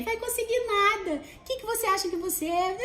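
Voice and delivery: high, sneering put-down; scornful inner voice. Retold or imitated. Inner critic crushing the speaker's hope, high and sneering. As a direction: scoff at yourself in a high voice. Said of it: "aí vem".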